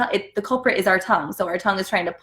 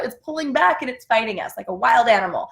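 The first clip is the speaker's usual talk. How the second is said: usual speaking voice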